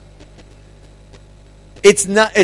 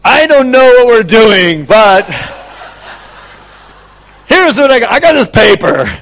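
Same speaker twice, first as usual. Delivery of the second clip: excited; 6 dB louder than usual. Retold or imitated. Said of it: "we're like".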